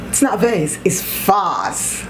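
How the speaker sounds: "It's not vase" with "vayss", then "it's vase" as "vahz"; vase versus vahz. Shown as a demonstration. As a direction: contrast "vase" ay against "vase" ah. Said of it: "I can debate on that one".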